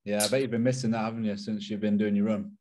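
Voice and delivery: ordinary talk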